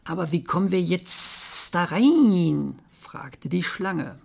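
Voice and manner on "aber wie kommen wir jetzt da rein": slow hissing snake with stretched sounds. Acted. Snake wondering how to get in, hissing slowly. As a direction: draw out the hiss like a snake. Snake asks in read story.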